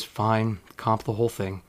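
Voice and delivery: mock exhausted manager, low and soft; weary resignation. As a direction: let it sag out of you like a tired manager. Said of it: "and he sighs really big".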